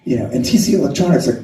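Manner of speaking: ordinary talk, nothing marked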